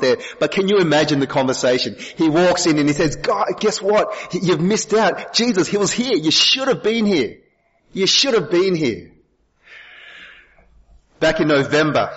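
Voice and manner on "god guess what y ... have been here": excited disciple. Acted. Disciple excitedly telling absent Thomas.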